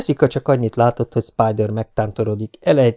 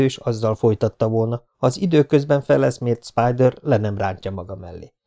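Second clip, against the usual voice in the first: ordinary delivery, nothing marked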